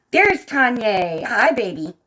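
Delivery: spotting a friend, gasping with delight. Real, not acted. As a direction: gasp then greet a friend happily. Spots a friend on screen.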